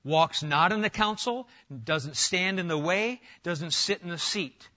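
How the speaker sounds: normal talking voice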